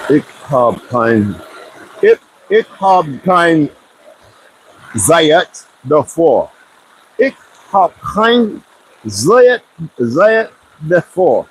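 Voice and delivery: slow, drawn-out \"Ich hab kein Zeit davor\", stretching each word; German phrase. Shown as a demonstration. Trying to recall German, slowly sounding out the phrase. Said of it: "it's German".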